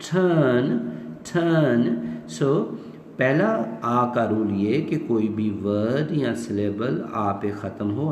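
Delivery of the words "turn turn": टर्न with puffed t and silent r, higher; British "turn", one r-less syllable, rising; aspirated British t. Shown as a demonstration. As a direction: say टर्न slowly with a breath after t. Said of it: "ठे पढ़ेंगे".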